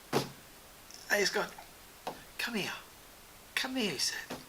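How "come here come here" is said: quiet, discreet retold aside; hushed summons. Jonathan pulling his friend aside at the party, hushed. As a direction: softly beckon him aside.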